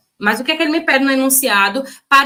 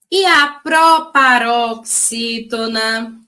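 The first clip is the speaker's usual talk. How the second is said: slow, clear recitation; teacher reviewing stress rules slowly; slow teaching; slower than usual. Shown as a demonstration. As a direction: spell out each term slowly. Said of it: "vamos relembrar".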